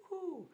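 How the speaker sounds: short high-pitched woo; little celebratory whoop; a quick, high "woo" cheer; playful woo. Pretend. Cheers own tenth video.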